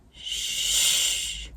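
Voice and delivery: an imitated, breathy shush; weird shush. Retelling a creepy father's shush, imitated softly. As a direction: hush someone with a strange long shh. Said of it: "He does this weird".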